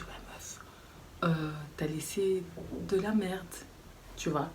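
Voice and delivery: soft whisper straight into her ear; whispered aside. Retold or imitated. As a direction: hush it so nobody else hears. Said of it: "je lui dis doucement dans l'oreille".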